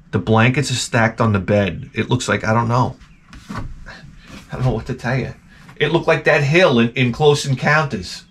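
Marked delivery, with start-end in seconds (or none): 3.5-5.3 whispered aside. Real, not acted.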